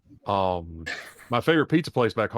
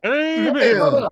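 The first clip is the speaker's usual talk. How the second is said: shouted amen; 13 semitones higher, 8 dB louder than usual. Pretend. Answering the hype call.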